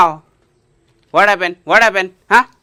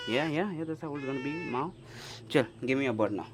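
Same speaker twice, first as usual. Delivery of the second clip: ordinary talk, nothing marked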